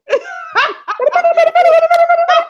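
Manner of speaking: high laugh. Real, not acted. Co-host cracks up.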